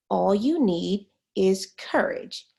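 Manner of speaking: normal talking voice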